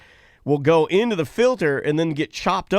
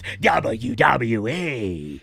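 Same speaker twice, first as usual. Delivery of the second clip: wrestling announcer; 3 semitones lower, much slower than usual. Pretend.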